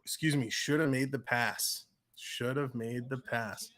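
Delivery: ordinary delivery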